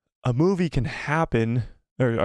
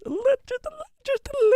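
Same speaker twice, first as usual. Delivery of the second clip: voice cracking. Real, not acted. Voice cracks mid-word.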